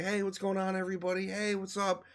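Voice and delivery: a hint of a showman working the crowd; celebrity greeting fans. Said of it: "He's like".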